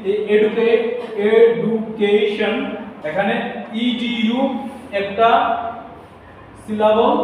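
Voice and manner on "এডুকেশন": এডুকেশন slowly, in separate syllables. Shown as a demonstration. Teacher showing a polysyllable, এডুকেশন slowly broken up. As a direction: stretch out each part of এডুকেশন. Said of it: "polysyllable. যেমন আমি লিখেছি".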